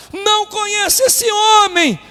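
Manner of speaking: loud, high, slow shouted oath; vehement, drawn-out disavowal at the top of the voice; swearing denial. Acted. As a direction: cry out the denial with full force. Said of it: "começou ele a praguejar e a jurar".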